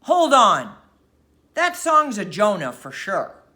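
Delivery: sudden loud roar; a sailor shouting over the music. A narrator's reading. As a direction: bellow it to stop the song. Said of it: "Tom Platt roared out".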